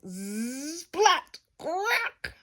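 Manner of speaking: buzz, high splat, creaky crack; buzzing and cracking sound effects; bed noises. A narrator's reading. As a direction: act out a machine breaking.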